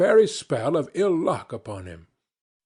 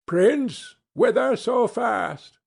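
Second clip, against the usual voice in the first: high, slow, sly dwarf voice drawing out the question; a little mountain imp teasing a rider; ugly little dwarf; 9 semitones higher, slower than usual. Acted. Dwarf on the rocks calls to the hurrying prince, high and sly.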